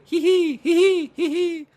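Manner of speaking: very high squeaky "hee hee"; teasing giggle. Acted. Teasing kangaroo by pulling food away, high mock giggle. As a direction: tease with little falsetto giggles.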